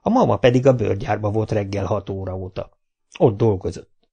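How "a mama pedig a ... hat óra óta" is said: ordinary delivery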